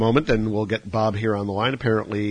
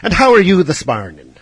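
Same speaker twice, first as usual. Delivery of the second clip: louder, higher stage Irish; hammed-up Irish accent; 6 semitones higher, 10 dB louder than usual. Host asks caller in a bad Irish accent. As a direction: ham up a bad Irish brogue. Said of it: "my horrible Irish accent".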